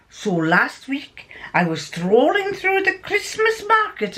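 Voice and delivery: hammed-up Scottish, often swooping high; theatrical put-on Scottish accent; terrible Scottish accent. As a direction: tell the tale in a bad Scottish accent. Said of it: "My Scottish accent's terrible".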